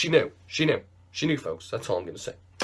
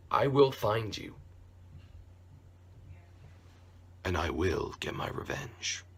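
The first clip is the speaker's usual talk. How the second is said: low, much softer mock threat; mock menace; 6 semitones lower, 11 dB quieter than usual. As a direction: promise revenge in a hushed villain voice.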